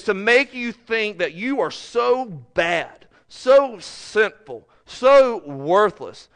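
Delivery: each "so" weighed out like a blow; emphatic preaching. Real, not acted. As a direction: lean hard on each "so", slowly. Preacher listing enemy's lies.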